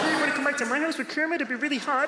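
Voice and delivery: high, fast Kermit voice; squeaky frog puppet voice; fast high Kermit impression. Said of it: "he did Kermit the Frog".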